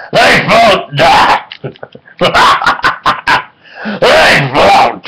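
hammed-up evil clown shout; Pennywise impression. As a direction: taunt it like the clown in the sewer. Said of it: "He's Pennywise the Clown".

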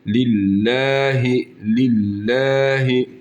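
billahi with a light thin l, lahi near læhi; thin lam. Shown as a demonstration. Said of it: "harfin harekesi ince". (billahi ince okunuşla)

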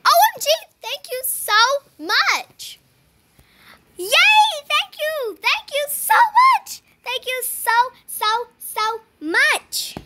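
high, loud, drawn-out thank you; thrilled thanks. Real, not acted. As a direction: gush with delight. Gets the neon pet.

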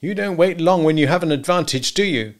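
high-pitched, bitter jab; bitter reproach. A narrator's reading. Husband to wife in night quarrel, bitterly. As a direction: needle her with the reproach.

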